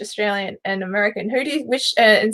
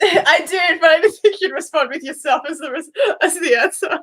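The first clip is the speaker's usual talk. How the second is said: talking through real laughter, pitched higher; laughing; 7 semitones higher than usual. Real, not acted. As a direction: laugh helplessly while getting the words out. Amused by the guest's answer.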